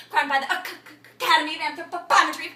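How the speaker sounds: halting, stuttered declamation; stammering. Acted. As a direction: stutter on the first syllable of Academy. Acted monologue, stuck on a word.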